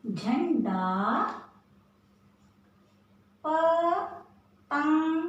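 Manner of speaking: झंडा, पतंग said very slowly with the ं held; teacher slowly modelling झंडा and पतंग; slow nasal demo. Shown as a demonstration. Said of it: "जिस भी व्यंजन पे".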